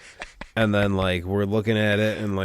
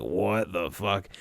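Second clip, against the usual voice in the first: slow, soft half-asleep mumble; groggy just woken; 3 semitones lower, 10 dB quieter, much slower than usual. Retold or imitated. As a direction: drag it out like you just woke up. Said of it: "Came out of a dead-ass sleep".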